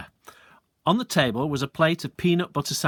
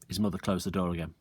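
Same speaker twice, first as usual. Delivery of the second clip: usual speaking voice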